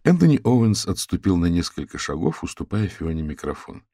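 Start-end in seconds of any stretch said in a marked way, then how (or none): none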